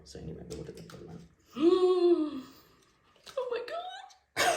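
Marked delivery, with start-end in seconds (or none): 1.6-4.5 gasp, then a very high slow oh my God; overwhelmed shock, voice shooting up. Real, not acted. Blue test shows line.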